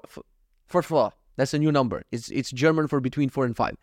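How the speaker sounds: ordinary talk, nothing marked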